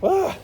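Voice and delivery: high strained grunt of effort; sharp strained cry lifting. Real, not acted. Straining with heavy door.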